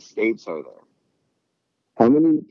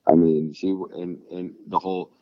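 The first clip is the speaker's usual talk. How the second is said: ordinary delivery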